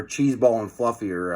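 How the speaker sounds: ordinary delivery, nothing marked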